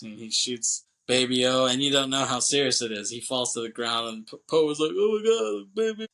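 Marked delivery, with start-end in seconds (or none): 4.9-6.1 high, half-crying imitation; mock tearful cry. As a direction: wail it in imitated distress. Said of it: "kinda crying".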